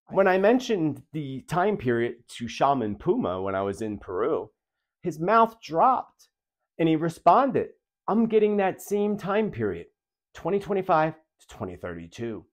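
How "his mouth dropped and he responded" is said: ordinary delivery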